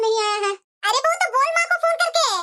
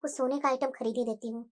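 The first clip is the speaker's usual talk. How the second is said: much lower, much softer inner monologue; soft thinking aloud; 13 semitones lower, 9 dB quieter than usual. Acted. Mother-in-law planning gold for her daughter, soft private murmur. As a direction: plan it quietly to yourself.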